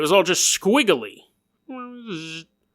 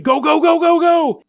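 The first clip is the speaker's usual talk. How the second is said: high frantic yell of go go go; screaming go; 9 semitones higher, 4 dB louder than usual. Acted. Frightened girl screams at the driver, high frantic yell. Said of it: "she just starts screaming".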